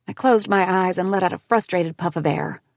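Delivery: usual speaking voice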